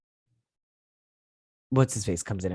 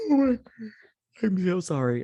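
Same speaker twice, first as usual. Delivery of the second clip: mock-plaintive, very high squeak at the start; high-pitched mimicry; 10 semitones higher, 4 dB louder than usual. Recapping a character's second thoughts, high-pitched mimicry. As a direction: imitate an apology in a high, squeaky voice.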